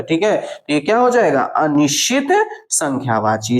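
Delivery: ordinary delivery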